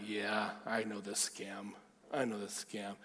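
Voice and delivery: low, soft, slow knowing dismissal; quiet, wary mumble of doubt; skeptical aside. Retold or imitated. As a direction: think it to yourself, unconvinced. His skeptical inner reply.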